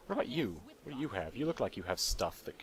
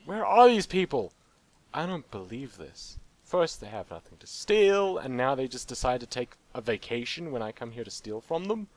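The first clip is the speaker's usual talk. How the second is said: louder, higher mock indignation; indignant outrage; 3 semitones higher, 7 dB louder than usual. Real, not acted. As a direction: complain the victims skipped work. Outraged targets gone.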